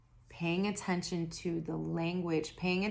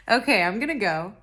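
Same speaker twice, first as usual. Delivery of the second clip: loud sign-off; 3 semitones higher, 10 dB louder, faster than usual. Real, not acted. Wrapping up, still laughing.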